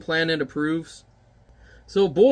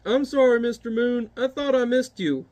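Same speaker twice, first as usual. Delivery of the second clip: high apologetic voice; sheepish giant; 7 semitones higher than usual. Acted. Giant apologizing to the moon, sheepish. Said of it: "He's like".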